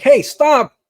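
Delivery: sharp, high, very loud call; hailing shout. Acted. Game master voices a security guard hailing a runner, shouting. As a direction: shout to halt someone running past. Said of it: "hail you down".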